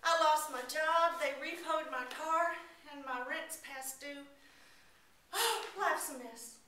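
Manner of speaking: high, loud broken confession; a touch of sobbing; desperate robber breaking down; mild tearful despair. Acted. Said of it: "fell to the ground in a pile of tears".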